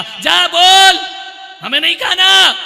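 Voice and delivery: father shouting he won't eat; angry father. Retold or imitated. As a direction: snap back at the boy. Angry father refuses food.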